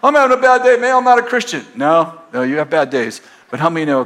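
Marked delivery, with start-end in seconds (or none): 0.0-1.6 high, loud mock moan of a bad day; mock complainer. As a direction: ham up the grumbling non-believer. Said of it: "everybody else is like".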